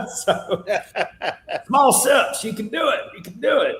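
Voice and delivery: high, laughing cheer of you can do it; laughing encouragement. Real, not acted. Teasing encouragement to sip, not spit.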